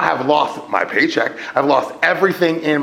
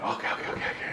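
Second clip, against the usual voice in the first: whisper; 10 dB quieter, much faster than usual. Retold or imitated. Calming self, naked in store.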